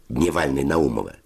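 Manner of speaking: ordinary delivery, nothing marked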